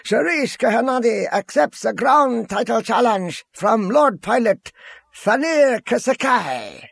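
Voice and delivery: very high ringside announcement; high, showy arena call; announcer's cry. A narrator's reading. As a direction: announce the fighters, stretching the final name. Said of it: "cried the announcer".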